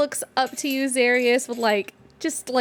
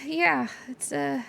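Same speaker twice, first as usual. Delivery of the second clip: high, halting and bashful; shy Gouda; 4 semitones higher, slower than usual. Acted. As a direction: stumble shyly over the words. GM voices Gouda.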